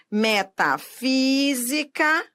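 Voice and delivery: slow Metafísica, FÍ drawn out; stress on antepenultimate syllable. Shown as a demonstration. Teacher showing a proparoxítona, long FÍ in slow Metafísica. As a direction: break up Metafísica and lean on FÍ. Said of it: "A sílaba tônica é a antepenúltima sílaba".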